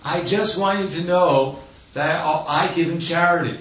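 high-pitched, eager retelling of a protest; acted-out excitement, pitched well up; very excited voice. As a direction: imitate someone excitedly pleading his case. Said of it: "said in a very excited voice".

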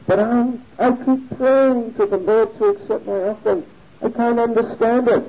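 high-pitched plaintive retort, falling at the end; bewildered protest. Retold or imitated. Confused devotee explains himself to Lord Chaitanya, plaintive. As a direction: act out helpless bafflement.

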